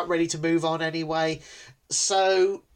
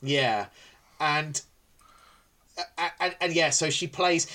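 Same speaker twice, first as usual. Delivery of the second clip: ordinary talk, nothing marked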